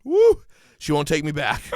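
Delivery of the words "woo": high, loud "woo"; short, loud falsetto cheer; whoop. Acted. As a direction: let out a high-pitched whoop. Whooping in a retold rant.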